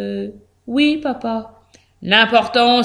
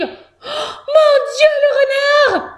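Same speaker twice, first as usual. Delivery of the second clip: very high-pitched, loud mouse wail; frightened cry; 4 dB louder, slower than usual. A narrator's reading. Mouse spotting the fox, crying out in terror. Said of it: "crie Josette".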